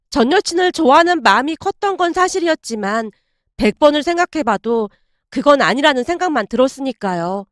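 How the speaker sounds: ordinary delivery, nothing marked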